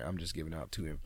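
ordinary talk

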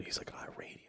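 whispered aside to a friend. Retold or imitated. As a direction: barely breathe the words out. Hushed talk next to radio people.

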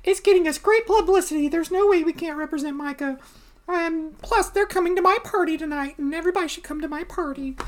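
high, quick society-hostess voice; Debbie the socialite. Acted. Debbie boasting about her party, high and quick hostess voice. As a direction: play a high-pitched, gushing party hostess.

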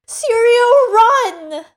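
high, loud scream of a name; frantic child's shout; desperate cry. Acted. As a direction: shout a warning to her teacher. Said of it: "Arya cries out in the book".

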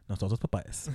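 low, hushed return greeting; solemn. Retold or imitated. Comedian bowing to a fellow listener, low solemn murmur. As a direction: murmur it back like a secret password. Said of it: "like, very seriously".

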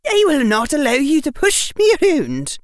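high, loud stern warning; tinge of danger. A narrator's reading. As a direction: lay down the law to a defiant boy. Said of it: "said with a tinge of danger".

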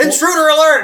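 high, loud mock alarm; alarm shout. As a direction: sound the alarm jokingly. Mock alarm as guest joins.

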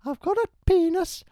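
very high, loud falsetto nanny voice, slowing on brute; Mrs Doubtfire impression. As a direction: ham up a shrieking old Scottish nanny. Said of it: "I'm Missus Doubtfire".

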